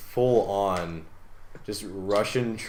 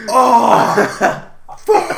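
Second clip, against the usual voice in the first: high shrieking yell of pain; retelling a friend's yelp when the mask hit his jaw, high shriek; mock pained yelp; 13 semitones higher, 11 dB louder than usual. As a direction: shriek it like you just got hit in the jaw. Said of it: "you were so like".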